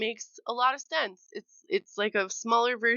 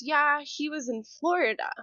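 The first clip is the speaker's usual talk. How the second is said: lightly voiced small child, higher; little daughter; 6 semitones higher than usual. Small daughter telling mother about her friend, matter-of-fact. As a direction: answer simply like a toddler, pitched up.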